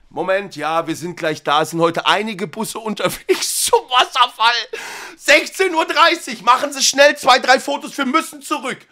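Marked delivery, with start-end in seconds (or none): 5.2-8.8 hurrying tour guide. Acted. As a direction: hurry them through the photos.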